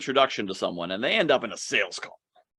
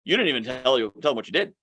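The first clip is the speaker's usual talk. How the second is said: ordinary talk, nothing marked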